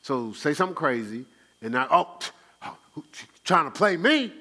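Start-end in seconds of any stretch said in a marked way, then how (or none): 1.9-4.3 loud, higher, offended retort; defensive outburst. Retold or imitated. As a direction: snap back like you've been insulted. Acting out reacting to provocation.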